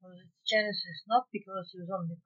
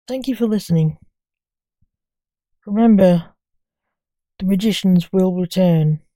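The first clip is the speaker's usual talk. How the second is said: much louder closing announcement; story sign-off; 14 dB louder than usual. Real, not acted. Storyteller thanks listeners after the end, loud sign-off.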